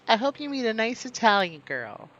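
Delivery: aunt's voice. Retold or imitated. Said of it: "she's like".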